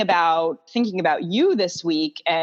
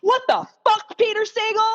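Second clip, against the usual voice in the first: outraged exclamation. Retold or imitated. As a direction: shriek it at the author in disbelief. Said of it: "And I was like".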